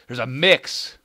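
high, loud exclaim; loud excited discovery. Real, not acted. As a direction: blurt it out excitedly.

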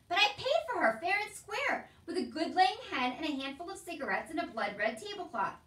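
loud, high outburst, settling slightly; indignant protest. Acted. Uncle protesting he paid for the girl, indignant. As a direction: argue it loudly as an injustice.

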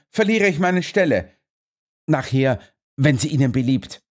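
a lad afraid for his job; lightly voiced anxious groom; nervous stable boy. A narrator's reading.

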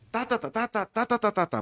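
high, rhythmic ta-ta melody; producer's demonstrated intonation. Shown as a demonstration. Producer showing the announcer the tune, high sing-song demo. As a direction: chant the tune on "ta", pitched well up. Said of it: "Por dar un ejemplo".

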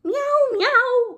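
very high, loud meowing; cat crying. Acted. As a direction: cry out like a hungry cat. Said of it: "cried Simpkin".

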